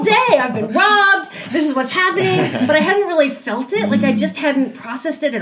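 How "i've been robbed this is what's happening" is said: higher-pitched mock outrage; hammed-up dawning alarm; retold shocked realization. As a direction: exclaim it as if it just hit you. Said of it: "it struck me, like, really what had happened".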